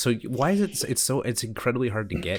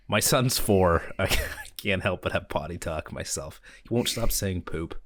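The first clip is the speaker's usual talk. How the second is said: usual speaking voice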